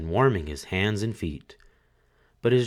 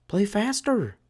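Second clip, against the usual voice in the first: much higher eager widow voice; widow urging; 13 semitones higher than usual. A narrator's reading. Widow urging the fiddler, much higher. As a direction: urge the fiddler on.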